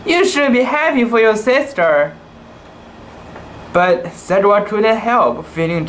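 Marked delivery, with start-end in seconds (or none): 0.1-2.1 higher, coaxing mother cat voice; a mother soothing her jealous kitten; gentle mother cat. A narrator's reading. Mama Meow gently coaxing jealous Satwa. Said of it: "said Mama Meow".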